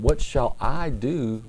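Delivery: "I" drawn out, high and loud; slow the line and punch "I"; stress on I. Shown as a demonstration. Preacher quoting Pilate on who acts, "I" heavily stressed.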